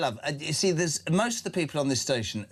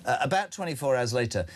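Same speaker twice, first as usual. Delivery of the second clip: ordinary talk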